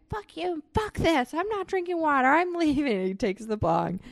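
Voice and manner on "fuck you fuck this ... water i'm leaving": high, whiny put-on voice of a drunk friend; mock petulant tantrum, pitched up; drunk friend storming off. Drunk Kenny refusing water and storming out, whiny high tantrum. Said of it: "he's like".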